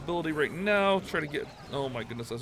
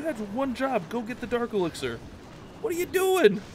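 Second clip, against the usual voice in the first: exasperated yell; 9 semitones higher, 5 dB louder than usual. Real, not acted. Troops going wrong way.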